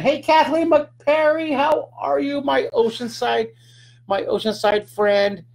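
high, slow, delighted call-out; excited greeting. Real, not acted. Calling out to a viewer.

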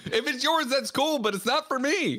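high-voiced, hammed-up refusal of someone else's taste; mock protest. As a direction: act out backing off in a high voice. Acting out an alarmed refusal.

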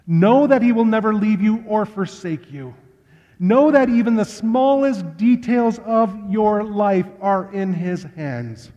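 high, loud preaching build. Real, not acted. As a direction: rise into full preaching fervour.

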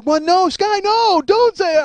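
high, pleading, close to crying; near tears. Retold or imitated. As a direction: beg him to stop, voice almost breaking. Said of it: "I almost cry".

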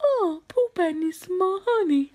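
soft cartoon bear voice; Pooh Bear. Attempting a cartoon bear, soft put-on voice. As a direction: speak softly like a storybook bear. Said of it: "meant to be Pooh Bear".

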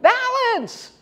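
high, loud shouted command; imitated father's yell. Father yelling to child pushed downhill on a bike, imitated loud high yell. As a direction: mimic a dad yelling after his child. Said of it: "And then he yelled".

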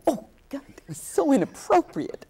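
high, mortified whisper; panicked whisper. Retold or imitated. Actress horrified after kissing Albee's neck, whispering. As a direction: whisper it in horror at yourself.

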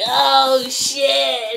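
stunned outburst, reenacted; retold shock. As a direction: react big to a bombshell. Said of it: "I was like".

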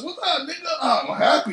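put-on high, laughing hello to an old friend; laughing greeting. Greeting Buddy, happy to see him.

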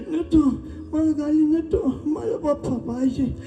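put-on high whimpering child, slowing at the end; crying little boy. Lost boy refuses the toy car, high acted crying. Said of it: "तो रडू लागला".

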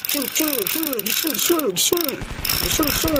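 high, soft hissing snake noises; soft high snake sounds for the children. Pretend. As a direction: hiss like a toy snake, slowly.

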